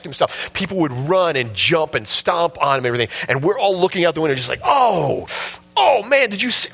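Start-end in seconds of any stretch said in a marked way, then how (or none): 4.6-6.7 high shocked exclamation; excited gasp. Retold or imitated. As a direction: react like a kid glued to the window. Kids watching fight from bus.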